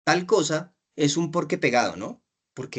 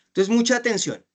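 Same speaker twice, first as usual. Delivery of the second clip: raised, higher call to listen up; firm call for attention; 7 semitones higher than usual. Real, not acted. Teacher raising voice to call students to attention. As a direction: lift the voice to grab the room.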